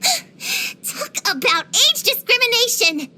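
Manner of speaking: high, drawn-out outraged protest; indignant. Acted. Protesting age discrimination, indignant.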